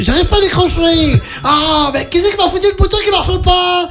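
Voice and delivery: flustered operator. Acted.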